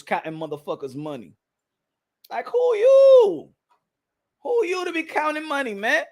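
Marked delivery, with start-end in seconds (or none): 2.3-6.0 high, drawn-out YOU; incredulous challenge. Real, not acted. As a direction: stretch it out in scornful disbelief. Scornful challenge to money counters.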